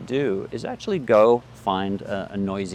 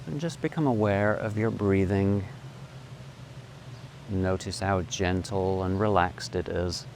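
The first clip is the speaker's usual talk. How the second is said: guided meditation; 3 semitones lower, 4 dB quieter than usual. Acted.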